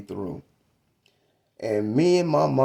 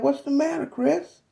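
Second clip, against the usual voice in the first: a higher, gently worried mother's voice; mama asking; 13 semitones higher than usual. Retold or imitated. Mother asking her crying young son what's wrong, lightly voiced. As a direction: ask like a concerned mom, lightly voiced. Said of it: "And she said".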